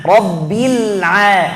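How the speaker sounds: Rabbil A drawn out very slowly, throaty ain; teacher models the right reading; correct ain. Shown as a demonstration. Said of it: "Yang betul itu mana?".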